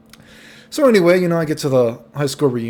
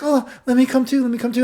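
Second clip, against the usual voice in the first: very high, whiny mock plea; imitated pleading drunk girl; 12 semitones higher, 4 dB louder than usual. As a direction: beg in a squeaky put-on girl voice.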